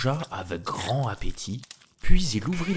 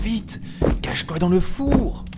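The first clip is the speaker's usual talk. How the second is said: high, louder, hurried cry; urgent warning; 10 semitones higher, 5 dB louder than usual. Acted. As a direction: hide him before the giant comes. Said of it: "s'écria la géante". (cri pressé et aigu)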